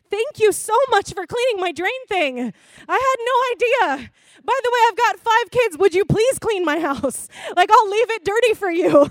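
loud, high, over-the-top gratitude; gushing thanks. Acted. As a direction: pour on delighted thanks to mom. Acted gushing thanks to mother.

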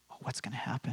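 hushed, breathy worry whispered; tense whisper. Retold or imitated. Guests in the silent room, a nervous whisper.

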